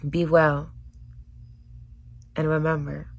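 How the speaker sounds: ordinary talk, nothing marked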